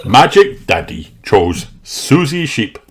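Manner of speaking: usual speaking voice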